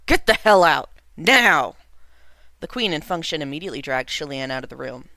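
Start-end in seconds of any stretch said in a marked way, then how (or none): 0.0-1.8 furious, much louder shout; king roaring at his son to get out, much louder; outraged shout. A narrator's reading. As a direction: throw him out in a rage. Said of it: "The king was outraged".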